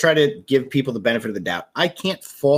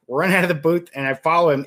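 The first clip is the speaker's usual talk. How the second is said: ordinary delivery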